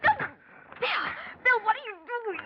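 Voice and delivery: high, panicked scream; terrified cry. Acted. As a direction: cry out in sudden terror. Grabbed and tied up suddenly.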